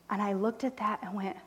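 ordinary talk

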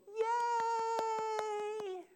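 very high, loud cheering yay; parent's squealing cheer for a fallen toddler. Retold or imitated. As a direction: cheer so the fallen child thinks it's fine. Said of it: "we would go".

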